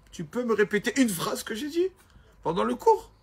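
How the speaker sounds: a questioning teacher, pitch rising toward the end; a rabbi testing a flatterer; teacher's challenge. Retold or imitated. Rabbi quizzing the student after class, questioning.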